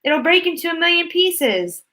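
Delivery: put-on frightened old man. A narrator's reading. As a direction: wail the disaster. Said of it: "shouted Grandpa Joe".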